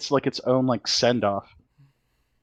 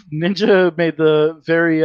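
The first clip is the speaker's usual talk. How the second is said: ordinary delivery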